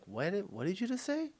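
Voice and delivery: imitated confusion. Confused person who didn't understand the car name, high puzzled voice. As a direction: act out a baffled listener asking for a repeat. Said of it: "They'll be like".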